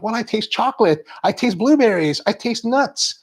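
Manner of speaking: lightly mocking judge voice. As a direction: parody a judge reciting tasting notes. Mimicking a judge's tasting notes.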